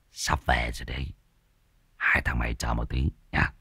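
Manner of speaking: low, hushed chuckle into a threat; chuckling schemer. A narrator's reading. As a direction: mutter it low after a soft snicker. Unseen figure chuckles, promises return.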